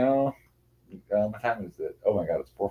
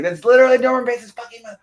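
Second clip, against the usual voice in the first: high, loud, rapid retold yell; exasperated retort; 9 dB louder, faster than usual. Reader retelling a retort about the tarot card, exasperated yell. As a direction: fire it back high, loud and fast.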